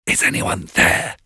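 hushed whisper in the dark. A narrator's reading. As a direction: whisper it so no one wakes. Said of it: "a man whispered".

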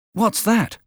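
a lightly voiced startled child; curious boy. A narrator's reading. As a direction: Pip asking about the gun. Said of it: "I asked".

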